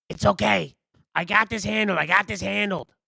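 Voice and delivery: high, loud "I've got this" soothing; calm the others down, a bit frantic; high nervous reassurance; reassuring character voice. Acted. Character calming the situation.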